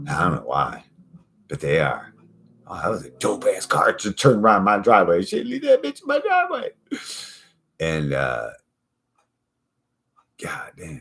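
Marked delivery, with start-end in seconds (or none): 2.7-6.7 fast, ending in a high squeal; mock young person. Next generation kid admiring a car, fast high squeal. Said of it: "young person quoted". 10.4-11.0 under breath whisper. Real, not acted. Imagining the future, whispered awe. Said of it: "unvoiced aside".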